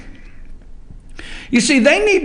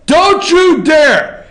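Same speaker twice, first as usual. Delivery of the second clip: mock warning; 7 semitones higher, 4 dB louder, much slower than usual. Real, not acted. Warning the booth again.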